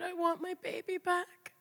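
high, strained cry of loss; anguished cry. Retold or imitated. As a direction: plead for the baby, pitch breaking upward. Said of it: "I just screamed".

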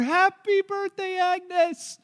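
high, slow, loud party cheer. Retold or imitated. Friends cheering Agnes as she walks through the door, high loud cheer. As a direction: belt it out like a surprise crowd. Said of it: "And they cheer".

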